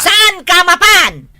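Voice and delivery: slow, loud, stern repeat; angry scolding. Acted. Wife forbidding husband to go drinking, slow stern shout. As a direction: hammer the question home.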